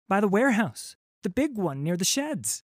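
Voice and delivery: put-on high, nervous boy voice; a scared lad blurting his news; frightened urchin. Urchin reporting to the pirate captain, high and nervous.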